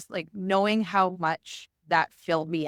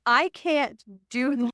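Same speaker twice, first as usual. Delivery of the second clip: retold crying; 5 semitones higher, 4 dB louder, much slower than usual. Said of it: "hyperventilating, crying".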